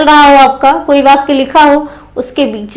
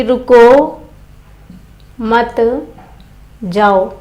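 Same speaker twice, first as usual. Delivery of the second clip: रुको, मत, जाओ spaced far apart; separated by long pauses; 3 semitones lower, 3 dB quieter, slower than usual. Shown as a demonstration. Teacher moving the punctuation mark, long pauses between रुको, मत and जाओ. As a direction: dictate it piece by piece, जाओ low and soft. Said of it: "इसी विराम चिन्ह को हम लगा दें".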